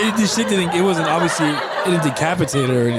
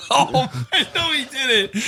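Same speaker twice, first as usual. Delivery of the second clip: high, drawn-out words through laughter; laughing; 7 semitones higher, slower than usual. Real, not acted. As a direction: crack up while saying it, pitch up. Cracking up at the story.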